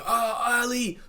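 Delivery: high shout of a name; Jay fighting the killer, high yell; fight cry. Retold or imitated. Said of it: "He gets up, he fights".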